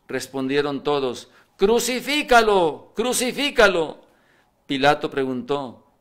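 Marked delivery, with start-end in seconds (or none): 1.6-4.0 crowd shout. Acted. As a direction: chant it at him as an angry mob. La multitud exige la crucifixión. (grito de la multitud, mucho más alto y fuerte)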